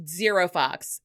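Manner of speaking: ordinary talk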